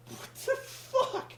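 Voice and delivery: high, slow, whispered disbelief; breathy disbelief. Real, not acted. Host reacts to the absurd match, high slow whisper. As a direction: gasp it out in disbelief.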